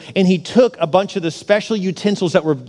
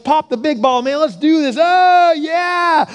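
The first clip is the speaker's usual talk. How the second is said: rowdy party king; 9 semitones higher, slower than usual. Acted. Drunk king cheering on the drinking, rowdy and building. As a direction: hype up the party, rising into a cheer. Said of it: "He's like".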